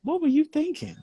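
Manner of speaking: very high mock disbelief; incredulous. Host imagining the guest's reaction, high mock disbelief. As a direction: toss it up high in mock shock. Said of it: "she's probably like".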